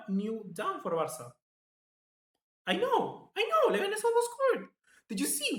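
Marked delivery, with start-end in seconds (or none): 2.7-5.6 high-pitched, loud, worked-up I know; nearly squealing at the near miss; excited disbelief. Real, not acted. Streamer to viewers, after Leganés nearly scores: high excited outburst.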